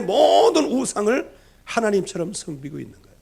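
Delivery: loud, high, rising sermon cry; preaching at full force. Acted. Preacher crying out to the congregation about idolatry, loud and high. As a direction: preach it out, pressing hard on every phrase.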